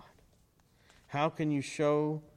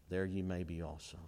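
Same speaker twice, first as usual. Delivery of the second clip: soft echo; 8 semitones lower, 8 dB quieter than usual. Acted.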